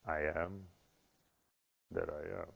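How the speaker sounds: low, hushed divine answer; voice of God. Acted. God answering in the synagogue, low and solemn. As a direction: speak softly and low as God.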